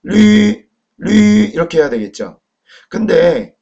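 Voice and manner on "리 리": 리 with the vowel held long and high; teacher modelling 리, raised and drawn out; pronunciation demo. Shown as a demonstration. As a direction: sound out 리 on a high, level, sustained note. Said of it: "이거 발음 어떻게 합니까".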